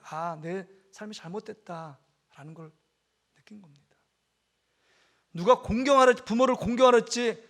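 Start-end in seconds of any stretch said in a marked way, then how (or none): 0.1-4.0 hushed realization. Retold or imitated. Child's inner realization, whispered.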